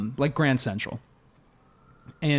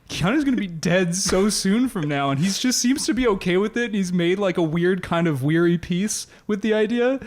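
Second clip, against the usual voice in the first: higher, half-laughing imitation of being in tears; mock tearful; 6 semitones higher than usual. Telling Dream Rachel the news, in a mock tearful higher voice. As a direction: retell the sobbing lightly, a pitch up. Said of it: "in tears to Dream Rachel".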